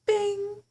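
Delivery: high, loud "bing" like a seatbelt chime; imitated chime. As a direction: ring it out like the cabin bell.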